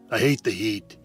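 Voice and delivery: ordinary delivery, nothing marked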